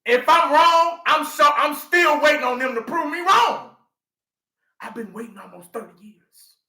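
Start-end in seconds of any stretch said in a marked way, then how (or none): 0.1-3.7 raised challenging shout. Real, not acted. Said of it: "I'm here to educate".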